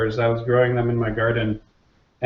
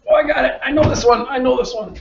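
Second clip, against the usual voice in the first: put-on much higher, excited child blurting; eager kid; 10 semitones higher than usual. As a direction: play a kid bursting to answer. Eager kid interrupting joke.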